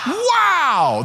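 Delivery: high, drawn-out, excited wow; enthusiastic wow. Shown as a demonstration. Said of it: "like, with some enthusiasm".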